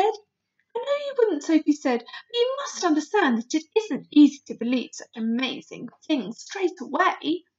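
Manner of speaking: lightly voiced earnest girl; Sophie. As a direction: explain apologetically, starting high.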